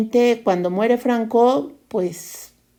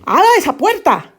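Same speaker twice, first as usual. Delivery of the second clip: blunt Spaniard command; 8 semitones higher than usual. Acted. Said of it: "cuando es más fácil decir".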